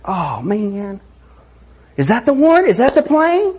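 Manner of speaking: put-on high excited kid voice asking; young daughters straining to spot dad's plane; eager little girls. Little girls at airport window.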